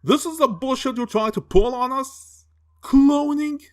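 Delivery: high-pitched, disbelieving questions; outraged disbelief, voice climbing high; incredulous outburst. Acted. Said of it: "And I'm like".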